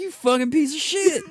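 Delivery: high, loud, hammed-up cursing; retold mock outrage. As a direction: yell the insult in playful indignation. Retold angry reply to prankster.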